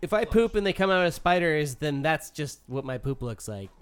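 normal talking voice

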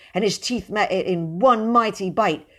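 ordinary delivery

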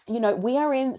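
ordinary delivery

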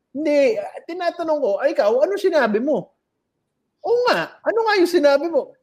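high-pitched, rushing, puzzled echo; baffled high stoner. Retold or imitated. Stoner asking his staring rival what he said, high and puzzled. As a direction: toss the question back, lost and quick. Second stoner answers, acted.

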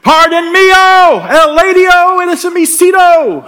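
ugly American shouting in Spanglish. Retold or imitated. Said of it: "we just get louder".

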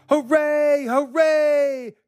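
high-pitched hooray shout; jubilant, high toy cheer; cheering cry. A narrator's reading. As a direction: cheer like a funny little clown. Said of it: "cried the funny little clown".

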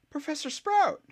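rescued at last; joyful relieved shout; glad cry. Acted. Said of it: "cried one of the Hufflepuffs, sounding as glad".

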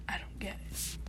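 breathy whispered repeat, to herself. Real, not acted. Vlogger repeating to herself, whispered. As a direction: whisper it like a private aside.

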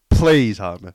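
plead, pushing the volume up. Pretend. Repeated louder plea.

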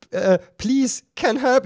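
high, strained, tearful plea; near tears. Retold or imitated. Begging the toll voice for help, near tears. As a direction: plead as if about to cry. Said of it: "Ich war echt kurz vom Weinen".

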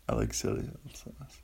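breathy whispered song line. Shown as a demonstration. Host murmuring the lyric to himself, whispered.

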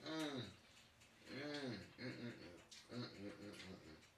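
soft mm of tasting soup; savoring hums. Real, not acted. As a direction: hum while savoring food. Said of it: "this soup is really good".

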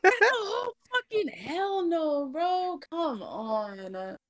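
high, slow horrified protest; freaked out. Real, not acted. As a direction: recoil in horror. Scared reaction to the photo.

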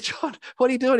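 high, loud, amused mock alarm; incredulous high-pitched retort; startled protest. Acts own startled protest to kiss.

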